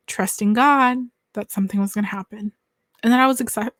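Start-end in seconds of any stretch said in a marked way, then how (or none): none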